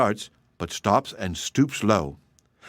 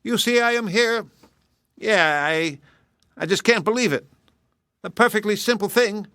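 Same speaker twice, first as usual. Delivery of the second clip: higher, puzzled hare voice; can't make sense of it; baffled hare; 11 semitones higher than usual. Acted. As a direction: hare bewildered at losing again. Hare bewildered.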